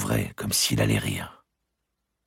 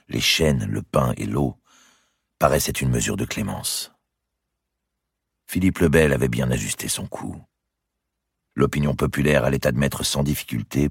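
ordinary delivery, nothing marked

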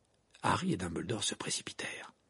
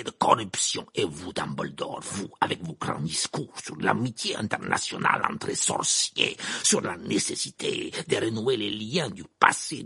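outraged Karkaroff; 10 semitones higher, 6 dB louder than usual. Acted. Karkaroff accuses Dumbledore of a plot, outraged tirade. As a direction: build to furious high shouting, then sink into bitter contempt.

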